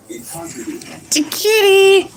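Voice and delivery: high, slow cooing at the kitty. Real, not acted. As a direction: coo it like talking to a pet. Talking to the cat.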